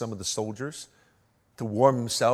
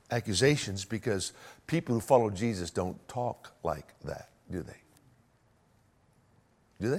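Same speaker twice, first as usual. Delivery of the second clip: ordinary delivery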